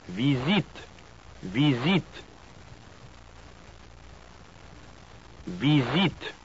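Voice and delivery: "Visite" with stress on the final syllable, z in the middle; French pronunciation. Shown as a demonstration. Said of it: "the last syllable is always stressed".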